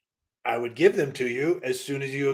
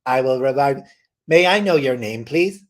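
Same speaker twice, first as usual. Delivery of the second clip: robot voice; 9 dB louder than usual. Acted. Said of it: "a whole robotic voice".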